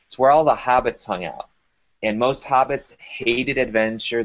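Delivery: ordinary delivery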